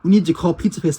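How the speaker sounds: ordinary talk, nothing marked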